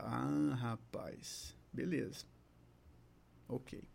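very soft, low private musing; thinking to oneself. Retold or imitated. Therapist pondering the patient's signs, low murmured thinking. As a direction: murmur it low, as a dawning thought. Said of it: "já comecei a, a pensar".

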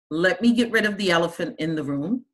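ordinary talk

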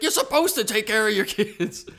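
loud, high-pitched comic indignation; mock outrage. Podcaster quoting a comedy bit about parents wanting credit, mock outraged. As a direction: scold like an exasperated stand-up.

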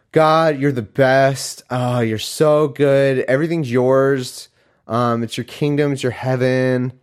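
drawn-out, gushing flattery; butter God up with slow, fake adoration; mock fawning praise.

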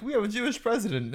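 high mocking voice of smug defenders; a high, smug mockery of the people posting; mocking quote. Mocking online defenders in a high, smug voice. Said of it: "I love the fact they're like".

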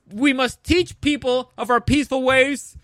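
building up to a loud, high mock shout; hammed-up preachy yell rising to the last words; mock zealot shout. Campion mocked preaching peace by force, rising hammed-up shout. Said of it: "And Campion is like".

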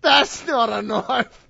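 put-on slow Australian accent, movie-line delivery; Crocodile Dundee. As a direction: quote the film in a hammed-up Australian accent. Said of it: "my basis for Australian accents".

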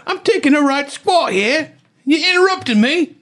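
high-pitched mock British indignation. Squatting driver annoyed at interruption, hammed-up British. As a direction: ham up an affronted British accent, very high.

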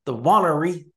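WANNERY with the first syllable stretched out; elongated name. Shown as a demonstration. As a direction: draw out the WAH in "Wannery". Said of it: "you elongate".

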